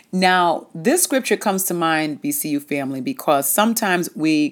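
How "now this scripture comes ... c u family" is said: ordinary talk, nothing marked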